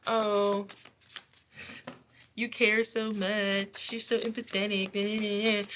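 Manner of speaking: drawn-out mock sympathy. Bullies making fun of the empathetic person, mocking. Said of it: "people like to make fun of".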